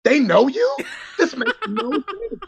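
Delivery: high, laughing disbelief; amazed disbelief. Retold or imitated. A friend can't believe fans know Tanmay, high laughing disbelief. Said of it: "And I was like".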